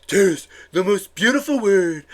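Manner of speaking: mangled, mouthy mock-politician voice; mock the candidate's odd way of talking; Donald Trump. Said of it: "literally was talking about tariffs all the time".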